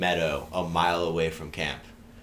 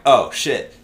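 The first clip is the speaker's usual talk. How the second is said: higher, louder retold exclamation; mock alarm; 7 semitones higher, 11 dB louder than usual. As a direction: act out a sudden realisation. Acted reaction to being dumped.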